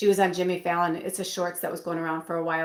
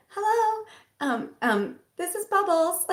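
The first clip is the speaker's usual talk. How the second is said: imitating a squeaky fairy clown, much higher; 12 semitones higher than usual. Dakota Johnson's clown answering the phone, high fairy voice. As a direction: chirp it high like a cartoon fairy. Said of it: "she was in this high voice".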